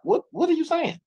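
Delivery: high-pitched retold shock; mock incredulous what are you saying; imitating his own stunned reply; disbelieving question. Retold disbelief at her request.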